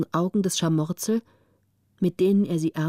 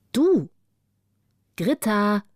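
a loud call across the sand; sharp call of Gritta's name; 4 dB louder, slower than usual. Acted. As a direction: call her name to wake her. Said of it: "rief eine Stimme".

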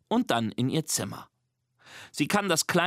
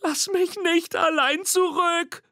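much higher, a scared little monster calling after Nina; squeaky call; 3 dB louder than usual. Acted. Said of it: "ruft Mimi".

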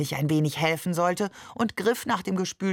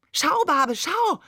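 put-on excited child; 9 semitones higher, 4 dB louder, slower than usual. A narrator's reading. Child shows off to Barbe.

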